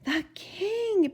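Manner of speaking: out of breath; panting. Acted. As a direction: gasp it out breathlessly. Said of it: "panted Bartholomew".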